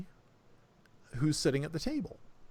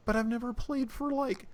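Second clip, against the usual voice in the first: put-on higher-pitched, hesitant girl's voice; nervous protest; 9 semitones higher than usual. Girl nervous of playing for the orc, hesitant. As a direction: protest timidly, pitched up, breaking off.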